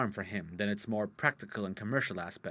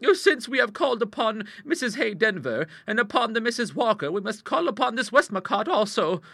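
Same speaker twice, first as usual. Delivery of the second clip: very high, loud agreeing spinster; timid old lady voice; 10 semitones higher, 5 dB louder than usual. Acted. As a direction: chirp your agreement like an elderly lady. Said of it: "acquiesced her sister".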